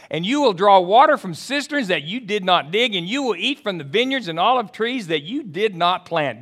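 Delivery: high, loud, driving sermon voice; rising pulpit fervor; full-force preaching. Acted. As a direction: preach it out to the whole room. Preacher building to climax.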